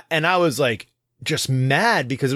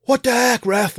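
high, louder outraged call at the ref; sideline yell at ref; 11 semitones higher, 4 dB louder, slower than usual. Retold or imitated. Whole sideline yelling at the referee after a kick, loud outraged. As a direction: protest the foul loudly.

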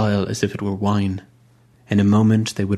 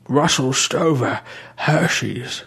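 higher, prim churchman praising the funeral; fussy old bishop; 5 semitones higher, slower than usual. A narrator's reading. Bishop listing buyers.

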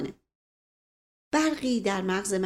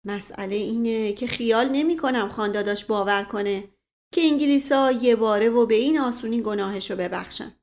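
ordinary delivery

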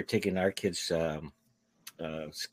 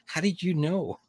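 higher, innocent mock surprise; 8 semitones higher than usual. Retelling his bar story with mock Canadian surprise. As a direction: act wide-eyed amazed. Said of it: "And I go".

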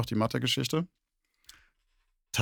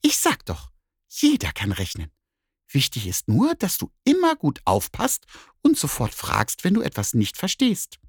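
much higher, delighted cat voice; 8 semitones higher than usual. Acted. Cat praises the bear's answer, high and delighted. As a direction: squeal with pleasure like a cat.

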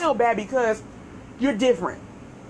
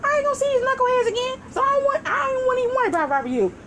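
put-on a kid calmly reassuring mom, pitched up; pleading to mom; 11 semitones higher than usual. Teen reassuring mom about teachers, lightly voiced high. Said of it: "I'm like".

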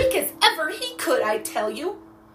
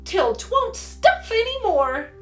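high, slow, emphatic merriment; merry; 14 semitones higher, 7 dB louder, slower than usual. A narrator's reading. Girl finishing her joke about the old stove, merry. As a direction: stretch the joke out loudly and cheerfully. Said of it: "cried Polly merrily".

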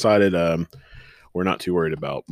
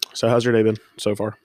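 ordinary talk

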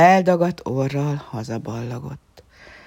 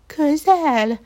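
high, louder worried squeak; uneasy mouse; 11 semitones higher, 7 dB louder than usual. A narrator's reading. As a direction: fret like a scared mouse. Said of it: "nyugtalankodott az egérke".

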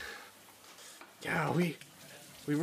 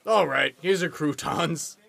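normal talking voice

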